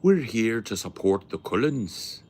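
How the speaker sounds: hammed-up Irish potato farmer vampire; Irish vampire accent. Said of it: "Irish potato farmer vampires".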